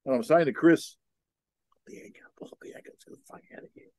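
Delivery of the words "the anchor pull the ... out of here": urgent hushed whisper. Retold or imitated. Captain whispers to his skipper to pull the anchor, urgent whisper. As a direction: whisper the order so nobody hears.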